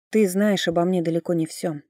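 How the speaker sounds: plain reading voice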